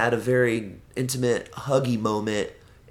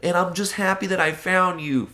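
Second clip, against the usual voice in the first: lying sweetly to the dog's owner; innocent voice; 9 semitones higher, 5 dB louder than usual. Retold or imitated. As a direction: pile on the innocence. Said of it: "I was like".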